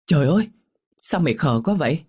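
aghast, voice shooting up; loud, shocked exclamation. A narrator's reading. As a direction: exclaim wide-eyed at your friend. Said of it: "đôi mắt trợn tròn nói lớn".

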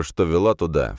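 usual speaking voice

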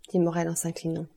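usual speaking voice

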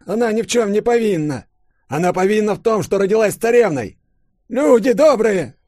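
put-on high, loud pleading old man; старик взывает. A narrator's reading. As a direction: cry out to the villagers for mercy. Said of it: "вдруг воззвал к деревенским Савельич". (старик громко взывает к людям)

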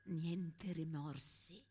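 hushed fading tribute. Acted. Widow honouring her fallen friend, hushed down to a whisper. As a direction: let each phrase fade until only breath is left. Mourning Maria's death.

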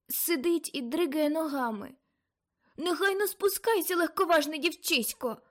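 rising to a scolding shout; storm vision cries. Acted. Aunt in trance seeing a storm, excited cries.

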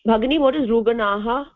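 normal talking voice

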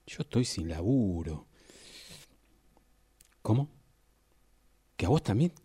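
usual speaking voice

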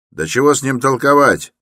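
high, loud, dismissive; shouting. A narrator's reading. Huge second porter cuts the talk short, shouting. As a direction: shout down the discussion. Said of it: "крикнул другой дворник".

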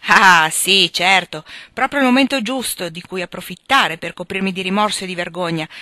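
loud scoffing agreement; sneering protest with a forceful start; bitter sarcasm. Acted. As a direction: scoff at the pious advice. Sick man scoffs at repentance.

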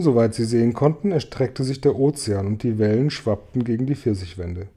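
ordinary delivery

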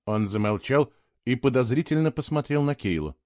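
plain reading voice

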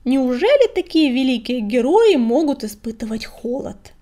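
loud, puffed-up taunt; snide question. A narrator's reading. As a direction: tease with mock amazement on a big breath. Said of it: "набрал побольше воздуху и ехидно спросил".

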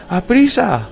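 high, loud urgent yell; panicked shout. Acted. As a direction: cry out in panic. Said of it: "gritó Wilson".

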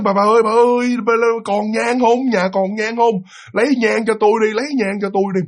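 high, held-pitch calls to his wife; retold urgent pleading. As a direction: imitate a man urgently begging for help. Retold frightened man calling for incense. (giả giọng ông hốt hoảng gọi bà, cao giọng)

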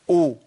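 loud "أو." as a glottal onset into an u vowel; hamza with damma. Shown as a demonstration. Teacher demonstrating the damma hamza, "أو." with a firm u. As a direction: sound "أو." as hamza plus rounded u. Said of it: "المضمومة؟".

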